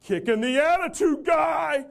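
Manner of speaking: loud, slow, high shout from upstairs; yelled call. Retold or imitated. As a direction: holler it down the stairs. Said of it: "yell down".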